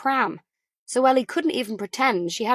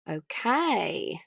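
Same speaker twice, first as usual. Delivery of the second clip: drawn-out okay; 4 dB quieter than usual. A narrator's reading. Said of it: "drew the final syllable out".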